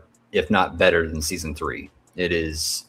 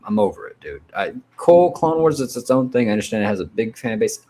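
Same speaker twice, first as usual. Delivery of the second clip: normal talking voice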